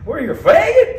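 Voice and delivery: lightly voiced Southern yokel. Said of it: "he's like, a hick from the South".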